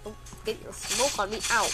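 normal talking voice

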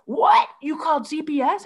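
imitating a high, loud cry of disbelief; shocked outburst. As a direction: burst out, mimicking his alarm. Husband jumps up, shocked at news.

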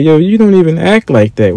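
disbelieving. Retold or imitated. Friend calling out an online tough guy, disbelieving.

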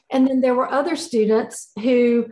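usual speaking voice

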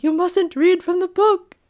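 a loud, high, hammed-up warning; mock ominous warning. Joking about the spooky book, a loud mock warning. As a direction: warn them off the book like in a horror film. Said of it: "But I was like".

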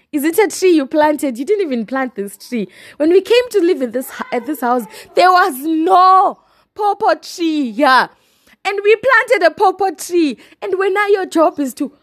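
loud and fed up; indignant rant. Real, not acted. Ranting at pawpaw scouts.

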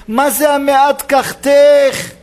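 slow, high and loud accusation; a biblical rival's furious retort; indignant shout. Acted. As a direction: hurl the question at her in outrage. Leah confronts Rachel, scolding.

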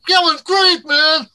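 high-pitched, hyped-up imitation of impressed fans; gushing admirers. Onlookers congratulating performers backstage, imitated high, excited gush.

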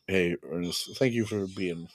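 low, hushed imitation of gratitude; mock heartfelt thanks. Imagining Frank thanking the ghost, low and softly sincere. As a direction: drop low and soft, trailing into near silence. Voicing a hoped-for thanks to Ernest.

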